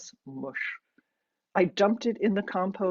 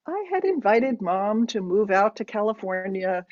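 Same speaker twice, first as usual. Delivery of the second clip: plain reading voice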